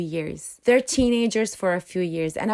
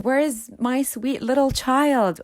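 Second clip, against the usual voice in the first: slow, higher, lightly wistful lament; mock parent lament; 6 semitones higher than usual. Parent of a teen mourning their lost child, lightly mock lament. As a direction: sigh it like a parent missing their little child. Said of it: "All these questions".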